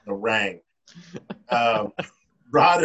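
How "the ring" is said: "rang" said with a flat a like "rang"; misspelled word read out. Shown as a demonstration. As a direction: read "rang" as written, rhyming with "bang". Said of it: "in the rang I wrote".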